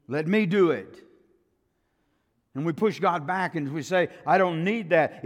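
loud, high defiant outburst; defiant shout. Acted. Sinner shouting to God that he needs nothing, in a loud defiant outburst. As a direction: push God away with the voice.

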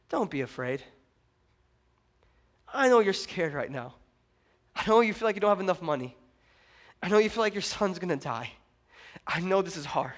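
calm someone who is scared; gentle reassurance. Acted. Elijah reassures the frightened widow.

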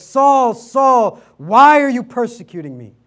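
loud, high booming call of Saul's name; booming voice from heaven. Retold or imitated. Jesus calling to Saul from heaven, loud booming call. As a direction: boom it down from the heavens. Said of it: "A great voice booms from the heavens".

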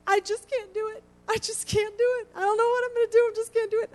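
high, whiny voice of a sleepwalking daughter, sobbing; crying daughter. Retold or imitated. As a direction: imitate a distressed daughter, high and pleading. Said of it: "and she goes".

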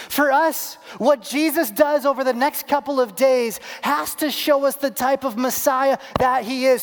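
high, forceful pulpit oratory; impassioned preaching. Acted. Preacher replaying Palm Sunday for the congregation, impassioned preaching. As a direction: drive each phrase home to the congregation.

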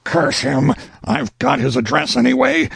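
high-pitched angry outburst; roaring. Acted. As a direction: curse him in a fury. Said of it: "roared Burley inwardly".

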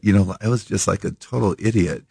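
ordinary talk

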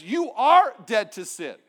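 slow, emphatic "You are dead to sin"; slow, firm declaration. Retold or imitated. Preacher proclaiming Paul's words, slow and emphatic. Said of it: "Rather, he says".

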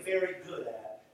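ordinary talk, nothing marked

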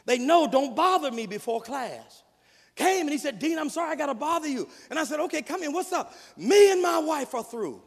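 loud, rising preacher's cadence; full-force preaching. Real, not acted. Preacher pressing the point on the congregation, full force. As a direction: drive it home from the pulpit.

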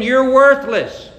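slow, high, loud taunt; hurled down at the camp, slow and loud; shout. Retold or imitated. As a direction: drag out a loud jeer. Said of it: "David shouts down".

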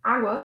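Água stressed on open "Á"; stress on first syllable. Shown as a demonstration. Teacher presenting the word, "Água" stressed on Á. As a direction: say "Água" as A-gwa. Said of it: "'cause the stress is here".

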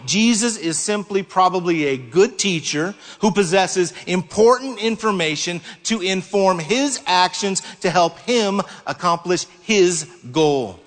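ordinary delivery

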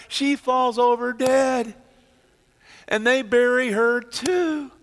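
preaching at full force. Acted. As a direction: drive the story home to the congregation. Preaching climax of Sapphira story.

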